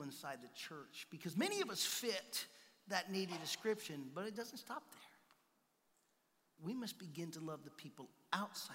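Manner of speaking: low, soft preaching; soft sermon. Real, not acted. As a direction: preach intimately and quietly. Preaching softly.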